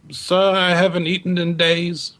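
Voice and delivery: a touch of a hungry beggar asking for alms; pleading beggar. Acted. Beggar on the dock asks a passerby, lightly voiced plea. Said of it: "he looks up at you and goes".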